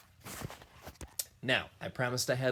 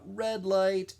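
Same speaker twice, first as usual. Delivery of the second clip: called out; 9 semitones higher, 4 dB louder than usual. Shown as a demonstration. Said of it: "the person shouts out".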